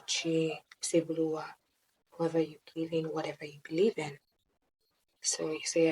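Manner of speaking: hushed whispered prayer; soft prayer. Acted. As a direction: pray softly to the universe. Leads a prayer.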